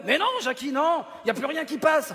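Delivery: high, loud objection; exasperated protest. Acted. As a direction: raise your voice right up in protest. Scolds puppet for offensive word.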